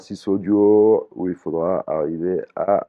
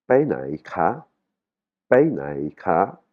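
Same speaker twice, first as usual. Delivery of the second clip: "Pai nai ka" slowly, each syllable on its own tone; shifting Thai intonation; much slower than usual. Shown as a demonstration. Teacher models the first phrase for students, shifting tones. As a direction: draw out "Pai nai ka" with a rise at the end. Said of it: "l'intonation, elle change".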